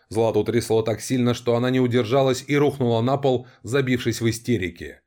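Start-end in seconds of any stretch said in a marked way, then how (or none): none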